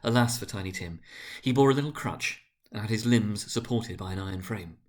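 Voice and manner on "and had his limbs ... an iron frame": ordinary delivery, nothing marked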